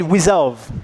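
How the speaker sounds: Resolve said as wizolve with a w. Shown as a demonstration. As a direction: repeat it with the w for r. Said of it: "Can you repeat?".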